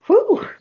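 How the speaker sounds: loud, high "whew" of release; sudden high, loud whew; high exhaled whew. Real, not acted. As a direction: blow off the built-up emotion in one whoop. Letting out emotion after reading.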